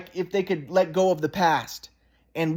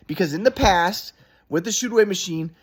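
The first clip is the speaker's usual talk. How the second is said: normal talking voice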